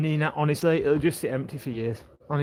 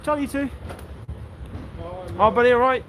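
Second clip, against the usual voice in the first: cheery greeting; 5 semitones higher than usual. Real, not acted. Greeting dog and passers-by.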